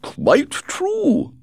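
high, chirpy, breezy society lady; gushing society hostess. A narrator's reading. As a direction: flutter through it like a gossiping hostess. Lady Windermere exclaims.

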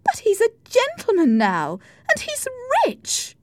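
very high-pitched, insistent defence; eager protest. Acted. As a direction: stand up for the man you love. Isabella defends Heathcliff.

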